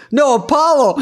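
high-pitched imitation of an insistent comic; mock indignant stage correction; arguing. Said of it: "he's arguing with him".

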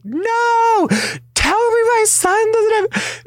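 high, loud, slow wail of a distraught mother; hysterical mother. Retold or imitated. Mother on the floor begging the doctor, a high wailing cry. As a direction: wail it, collapsing in despair.

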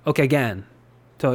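ordinary delivery

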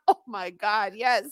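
ordinary delivery

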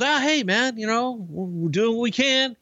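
Coach downplaying. Coach downplaying his party plans to Bruce, high and sheepish. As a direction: play it small and offhand, voice up high. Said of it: "He goes".

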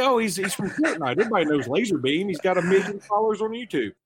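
imitating a kid. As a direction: play the excited kid explaining. Kid's voice in retold scene.

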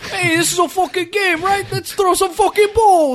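hammed-up East Coast accent. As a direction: egg on the carnival game like a Jersey bro. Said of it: "everybody from the East Coast sounds".

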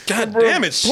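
ordinary delivery

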